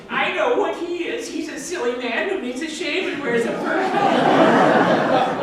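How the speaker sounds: put-on baby bear voice. Baby zoo bear teasing the bear, high and giggling. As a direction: squeak it like a tiny bear cub. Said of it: "A baby bear said".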